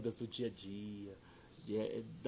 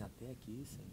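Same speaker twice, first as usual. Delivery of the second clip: softer, giving up to the Lord; resigned prayer; 10 dB quieter than usual. Retold or imitated. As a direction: let it fall away, defeated.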